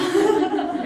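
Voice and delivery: high, loud burst of real laughter. Real, not acted. Preacher laughing at the old pastor's line, high burst of laughter. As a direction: cracks up at his own story. Retelling funny Walmart story.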